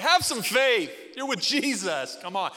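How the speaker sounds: mock-scolding, high and loud at the start; impatient exhortation. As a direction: blurt it out like an exasperated comment, easing off at the end. Acts out an impatient online comment.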